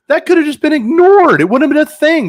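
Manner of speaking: high, loud exasperated outburst. Real, not acted. Complaining that the mayor had to stop and talk, exasperated. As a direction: raise pitch and volume in real exasperation.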